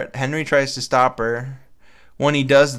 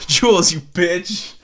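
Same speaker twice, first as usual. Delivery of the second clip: higher, louder, slow laughing cry; laughing outburst; 5 semitones higher, 6 dB louder, slower than usual. Acted. Reading the hero's curse at the cat villain, laughing loudly. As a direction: yell the name through laughter.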